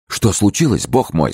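alarmed. A narrator's reading. Alarmed friends question Fouquet.

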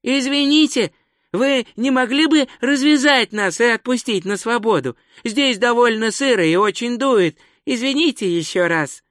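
высокий вежливый голос туриста, раздельная речь; обращайся как к непонятливым иностранцам, высоко и отчётливо; громко, чётко, раздельно. A narrator's reading. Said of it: "Громко, медленно и чётко выговаривая слова". (громко и чётко выговаривая слова)

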